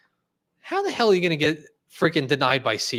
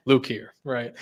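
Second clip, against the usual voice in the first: Luke impression; 2 semitones lower, slower than usual. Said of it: "we could do a Luke impression".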